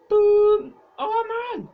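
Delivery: explosive "boom" then dismay; loud shocked outburst. Real, not acted. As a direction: shout at the engine failure. Engine blows up.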